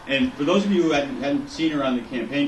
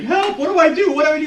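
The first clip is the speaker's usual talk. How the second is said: high, loud frantic yelling; mock terrified scream, much higher; panicked cry for help; 8 dB louder than usual. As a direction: imitate a man fleeing a bull, shrieking for help. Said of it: "he's screaming for help".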